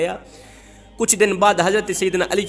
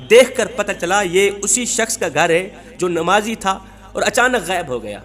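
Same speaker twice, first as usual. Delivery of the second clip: usual speaking voice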